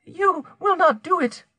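very high, entreating woman's voice; pleading. A narrator's reading. Guilty woman begs her blackmailer, pleading. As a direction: beg him in a high, frightened voice. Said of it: "entreated the guilty woman".

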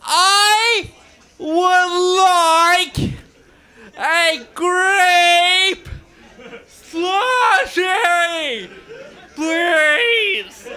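high, slow, tearful whine; repeating the slushie order, high and tearful; crying plea. Retold or imitated. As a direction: beg for the slushie through mock tears. Repeating his order, near tears.

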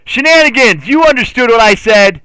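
high, loud accusing shout; mock outrage. Streamer accuses a chatter of lying, in loud mock outrage. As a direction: yell it like a hammed-up accusation.

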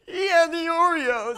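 high, loud tearful wail, drawn out; mock crying. Crying child blames brother to mom, high mock wail. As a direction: imitate a kid wailing through sobs. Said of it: "I'm sitting there crying".

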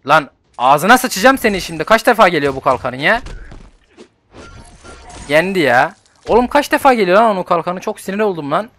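loud, high angry rant at the game; boiling over at the enemy; furious rant. Real, not acted. Gamer raging as the enemy shield returns, furious rant.

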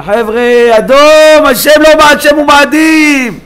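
high, loud drawn-out cry. Acted. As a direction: cry out to the crowd in alarm. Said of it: "שיצעקו". (זעקה גדולה)

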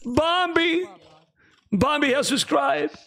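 high-pitched overjoyed shouting of a name; thrilled, voice jumping up over a new subscriber; emotional excitement. Real, not acted. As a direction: caster overwhelmed with joy, high and bursting. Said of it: "I'm emotional, man".

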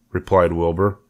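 ordinary delivery, nothing marked

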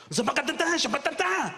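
high gibberish imitating French speech; mock French gibberish. Ride attendant talking to his mate in mock French gibberish. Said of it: "turns to his mate and goes".

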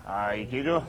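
ordinary talk

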